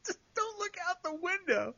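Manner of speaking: high, squeezed-out words while laughing; helpless laughter. Real, not acted. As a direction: fight the giggles and lose. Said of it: "I don't know why I'm laughing".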